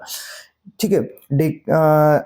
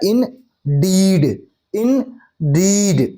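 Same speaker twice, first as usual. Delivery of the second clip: indeed split, light "in" and long heavy "deed"; stress on second syllable; much slower than usual. Shown as a demonstration. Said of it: "unstress कैसे है?".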